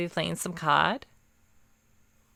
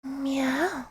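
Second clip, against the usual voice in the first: soft high kitten meow; cat girl meow; 6 semitones higher, 6 dB quieter than usual. Acted. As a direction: purr it like a shy cat girl. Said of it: "some cat girl A S M R".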